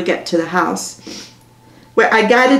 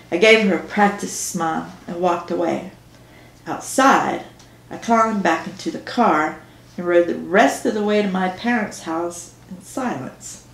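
usual speaking voice

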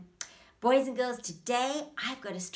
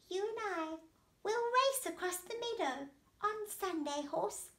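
little snail accepting; 12 semitones higher than usual. Acted. Snail agrees to race the horse, high small voice.

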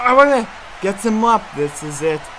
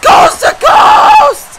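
much higher, louder, stretched scream of coast to coast; all-out announcer scream, much higher and slow; screamed hype call; 7 dB louder, much slower than usual. Acted. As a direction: draw out every coast like the crowd just erupted. Calling a wrestling finisher.

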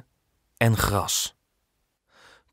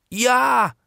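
much higher, louder shout of yes; eager yes; 13 semitones higher, 4 dB louder than usual. Acted. Children promising to help build the castle, high eager shout. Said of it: "roepen Kolletje en Dirk".

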